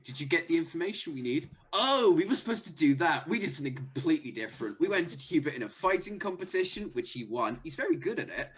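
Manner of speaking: character voice. Acted. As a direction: rattle off the misadventures. Player voices character reporting back.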